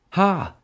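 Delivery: high triumphant crowing laugh. Acted. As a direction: crow it out in triumph. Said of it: "Summer crowed".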